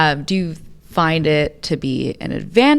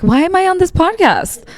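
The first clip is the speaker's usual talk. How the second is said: much higher, louder anxious self-doubt; anxious inner voice; 7 dB louder than usual. Retold or imitated. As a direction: squeak out the self-doubt in a high, nervous voice. Imposter syndrome inner voice.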